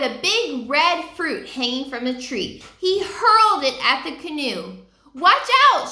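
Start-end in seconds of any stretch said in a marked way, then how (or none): 5.1-5.9 high, loud warning shout; urgent warning shout. Acted. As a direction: yell a warning. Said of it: "shouted Jack".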